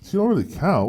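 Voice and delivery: high incredulous repeat; incredulous echo. Real, not acted. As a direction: echo it in disbelief. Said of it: "What do you mean".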